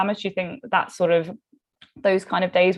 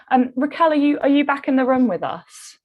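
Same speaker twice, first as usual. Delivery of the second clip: higher, louder call to an absent guest; 8 semitones higher, 4 dB louder than usual. Real, not acted. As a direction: call out hopefully to see if she's there. Checking if dropped guest returned.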